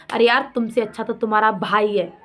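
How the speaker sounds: ordinary delivery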